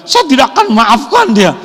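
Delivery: high, loud defiant vow; hammed-up refusal to forgive; mock stubborn grudge. Meniru orang yang dendam. (meniru sumpah tidak memaafkan dengan lantang)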